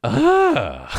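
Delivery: high strangled groan of frustration; frustrated groan. Retold or imitated. Boss at a lazy contractor, high fed-up groan. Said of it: "and you just go".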